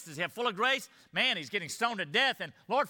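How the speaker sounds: ordinary talk, nothing marked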